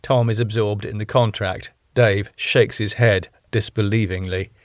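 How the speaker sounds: normal talking voice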